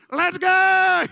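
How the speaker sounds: very high, loud whoop; the Cajun kid cheering for LSU; Cajun kid's cheer. Retold or imitated. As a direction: holler it like a proud Cajun kid. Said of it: "Cajun kid in our school".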